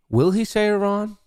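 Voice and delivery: high-pitched teasing question, held up high. Pretend. Streamer weighing a bet on Iran aloud, high teasing question. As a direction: pose it high, as one side of a debate.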